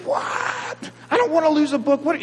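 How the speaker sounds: put-on high-pitched child's whine, breathy start; whiny son. Pastor imitating his son's high whine over a lost book. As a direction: mimic a sulky kid losing a book. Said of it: "he's angry".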